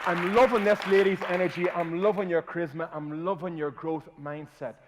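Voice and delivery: very loud, high cheering praise; host cheering on the audience's energy; hype. Real, not acted. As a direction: pump the crowd up. Hyping the audience.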